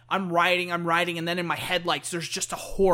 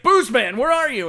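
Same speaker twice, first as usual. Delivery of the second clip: loud, raised searching call; 5 semitones higher, 8 dB louder than usual. Retold or imitated. Deacon calls his drunk friend, loud searching call. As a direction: call out for a missing buddy.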